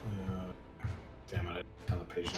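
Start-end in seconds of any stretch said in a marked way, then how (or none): none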